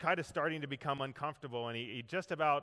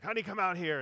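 calling out; 5 semitones higher, 4 dB louder, faster than usual. Retold or imitated. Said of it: "called out to his wife".

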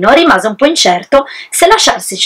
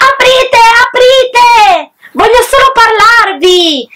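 much higher, slow, drawn-out shout; wolf shouting; 13 semitones higher, much slower than usual. Acted. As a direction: pound the door and call them out.

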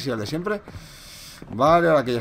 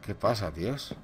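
soft, low and bewildered; hushed confusion; 7 semitones lower, 8 dB quieter than usual. Real, not acted. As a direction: murmur it lost in the dark.

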